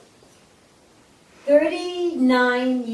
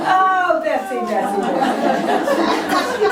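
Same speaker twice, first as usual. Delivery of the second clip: calling her name through laughter; can't get the words out for laughing; 7 semitones higher, 4 dB louder, much slower than usual. Real, not acted. Telling her audience the joke, laughing through the name.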